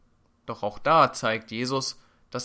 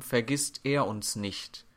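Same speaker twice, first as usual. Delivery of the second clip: ordinary talk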